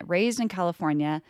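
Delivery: ordinary talk, nothing marked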